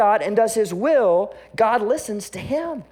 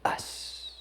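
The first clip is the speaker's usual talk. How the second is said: hushed ending; 16 dB quieter than usual. Retold or imitated. Leaders' line ending in a hush.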